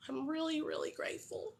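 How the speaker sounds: soft, choked gratitude near tears; getting emotional. Real, not acted. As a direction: fight back tears while thanking. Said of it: "I will probably get emotional".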